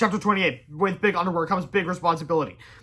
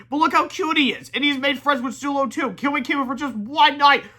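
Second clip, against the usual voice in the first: pleading; 9 semitones higher, 5 dB louder than usual. A narrator's reading. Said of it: "said Harold sadly".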